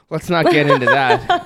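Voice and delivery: loud laughing dismissal. Real, not acted. Laughing off a touchy topic.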